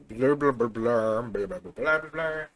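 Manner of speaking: quick mock blah-blah filler; nonsense babble. Storyteller skipping over part of the plot, playful babble. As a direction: babble loosely to skip ahead.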